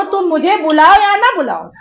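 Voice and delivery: ordinary talk, nothing marked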